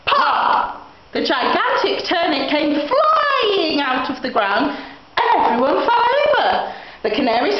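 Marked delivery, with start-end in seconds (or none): 0.1-0.9 a high, breathy "Pop!" like a cork; popping sound. Acted. Narrator voicing the turnip flying out, a high pop. 4.7-6.7 higher, laughing through the words. Acted. Narrator telling children about the fall, laughing. Everyone tumbles down, comic moment.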